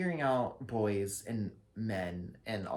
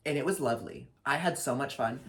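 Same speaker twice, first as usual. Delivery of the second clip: ordinary talk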